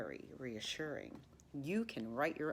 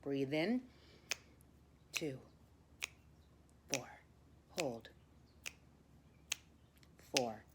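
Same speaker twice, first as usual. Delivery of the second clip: calm counted breathing cues; guided breathing. Acted. Teacher counting a class through breaths, calm guiding voice.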